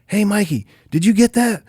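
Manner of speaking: lightly voiced drummer shouting.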